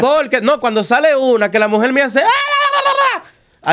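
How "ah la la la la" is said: very high mock shriek at a cockroach; wife shrieking. Wife spotting a flying cockroach, mock high shriek. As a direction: squeal it like the scared wife.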